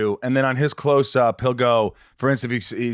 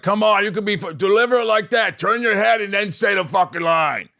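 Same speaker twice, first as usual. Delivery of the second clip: Sandler directing; 7 semitones higher than usual. Retold or imitated. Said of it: "he's like".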